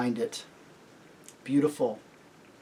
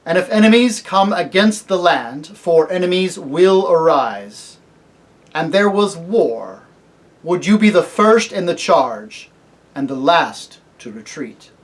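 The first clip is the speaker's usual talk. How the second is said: solemn kingly lion; 4 semitones higher, 10 dB louder than usual. Acted. Aslan asking the cabbie about war, solemn. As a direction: ask it as a grave summons.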